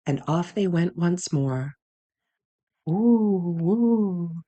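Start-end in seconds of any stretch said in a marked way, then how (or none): 2.8-4.4 long swooping "woo" like wind; airy wind howl, rising and falling. A narrator's reading. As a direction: imitate the wind in drawn-out hoots. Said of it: "howled the wind".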